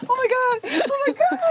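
very high, giggly squeal of amazement; mock surprise. Host echoing guest's surprised reaction, very high mock squeal. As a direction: squeal it in exaggerated shock, half laughing. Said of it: "wenn du überrascht bist".

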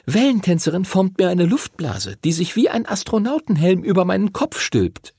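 put-on high-pitched girl character, explaining.

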